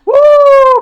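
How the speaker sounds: high, loud eerie bird hoot; piercing, long-held "woo" call; imitated bird call. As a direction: imitate an unidentified night bird. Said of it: "막 이런 소리 나".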